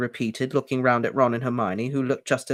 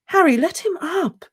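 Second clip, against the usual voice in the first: whispered; 5 dB louder than usual. A narrator's reading. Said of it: "Hermione whispered".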